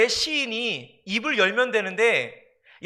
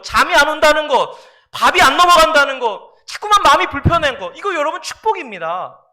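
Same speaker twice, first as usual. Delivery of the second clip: loud, raised sermon delivery; impassioned preaching; 5 semitones higher, 7 dB louder than usual. Real, not acted. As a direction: drive each point home to the congregation. Preaches at full force.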